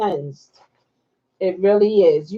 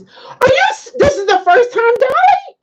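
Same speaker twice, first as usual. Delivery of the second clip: very high, loud surprised cry; surprised squeal; 4 dB louder than usual. Real, not acted. Greeting a first-time viewer, squealing high.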